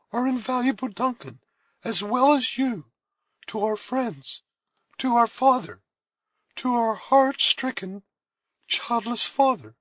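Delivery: high, gentle, motherly voice; maternal tenderness. A narrator's reading. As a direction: comfort a younger sister. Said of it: "in an expression of tenderness that seemed maternal".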